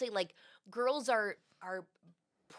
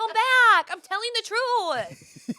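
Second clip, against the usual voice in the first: high, loud, drawn-out plea; mock desperate calling; 11 dB louder, slower than usual. Grandpa calling after kids who tuned out, mock desperate shout. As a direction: holler after them in hammed-up desperation.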